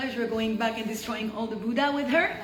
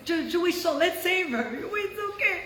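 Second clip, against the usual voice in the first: high-pitched, lightly imitated coaxing; 7 semitones higher than usual. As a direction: imitate mom gently urging, voice lifted. Mom coaxing reluctant dad.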